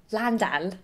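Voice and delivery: quick, self-mocking stab at a London accent. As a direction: say "Landan" with the vowels opened up, a mock London accent. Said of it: "Okay, that's terrible".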